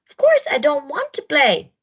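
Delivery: loud cross refusal; grumpy snort. Acted. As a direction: bark the refusal like a sulky hippo. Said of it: "snorted Hudson".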